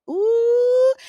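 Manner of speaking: high, loud delighted squeal; excited ooh. Real, not acted. Reacting to the juicy reveal with a high, loud squeal. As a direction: shriek with gossip glee.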